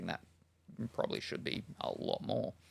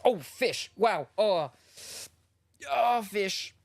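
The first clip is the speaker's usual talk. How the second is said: high, loud startled yelps; real pained fright; 10 semitones higher, 5 dB louder, much slower than usual. Real, not acted. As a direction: cry out at a sudden painful blast. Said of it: "it was like a fright and a pain all at once".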